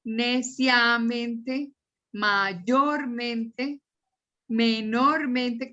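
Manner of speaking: stretched words, each part set apart; slow, broken-up delivery. Shown as a demonstration. Teacher listing adverbs ending in mente, slowly stretched out. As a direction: draw out every adverb before "mente".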